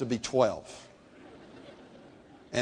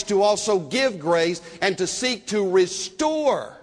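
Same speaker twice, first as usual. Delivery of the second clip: fervent preaching; 5 semitones higher than usual. Acted. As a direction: press the point home from the pulpit. Preaching at full force.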